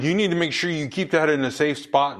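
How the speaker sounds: hammed-up parental panic; freaking-out parents. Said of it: "the parents are freaking out".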